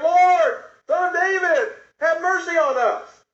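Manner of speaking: high, loud, slow plea. Acted. Blind men shouting over the crowd, loud high plea. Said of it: "they shouted all the louder".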